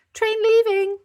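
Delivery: very high loud sing-song call; train conductor call. Acted. Bingo driving the toy train, conductor call. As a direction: announce it like a conductor. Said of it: "called Bluey".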